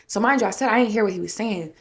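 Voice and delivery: ordinary talk, nothing marked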